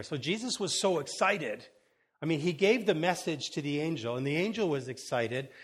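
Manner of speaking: ordinary talk, nothing marked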